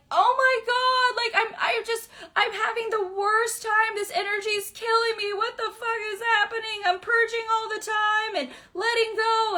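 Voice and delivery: high, whiny mock despair; mock overwhelmed whine. Imitating someone who never learned the lesson, high mock despair. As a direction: whine it like someone drowning in bad energy. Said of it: "pretty soon you're gonna go like".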